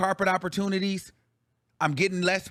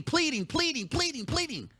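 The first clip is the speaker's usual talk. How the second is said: high, softer repeated falls; mock pleading; 7 semitones higher, 5 dB quieter than usual. Retelling how everybody on the show begged, mock pleading. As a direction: repeat it in a raised, sing-song imitation of begging. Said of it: "Everybody on the thing was pleading".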